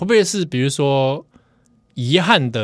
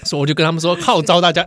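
normal talking voice

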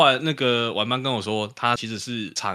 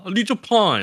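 much higher, nagging Taiwanese taunt from a rude customer; mocking Taiwanese; 10 semitones higher, 5 dB louder than usual. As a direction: play the customer needling in Taiwanese, high and sing-song. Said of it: "然后讲台语说".

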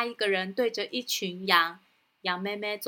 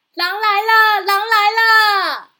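much higher, loud, slow yelling; panicked shout; 11 semitones higher, 13 dB louder, slower than usual. Acted. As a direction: cry out again to fool them. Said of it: "然后对着山下大喊". (大喊)

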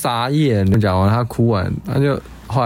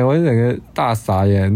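ordinary talk

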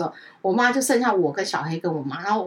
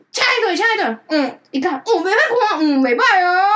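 high, loud imitation of a resigned aunt; mock helplessness; 8 semitones higher, 5 dB louder than usual. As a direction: play up the helpless sighing auntie. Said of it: "这种很无奈". (学阿姨夸张地表示无奈)

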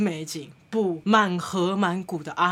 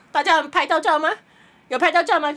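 higher, louder guide call; mock guide; 7 semitones higher, 4 dB louder than usual. Guide asking tourists if they got photos, mock guide call. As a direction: ask it like a tour guide.

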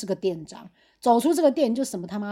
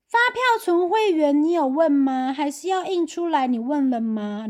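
high, slow nagging boss voice; nagging manager; 5 semitones higher, slower than usual. Retold or imitated. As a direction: drag out each question like a bossy manager. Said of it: "女店长又再讲一次". (拖长音唠叨的女店长)

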